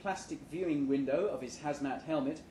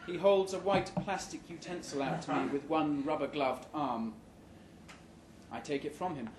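ordinary delivery

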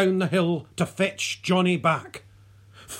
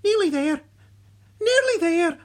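high, loud coaxing call; raised-pitch chivvying; encouraging; 11 semitones higher, 5 dB louder than usual. A narrator's reading. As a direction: urge him along, nearly there. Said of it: "he kept encouraging Johnny".

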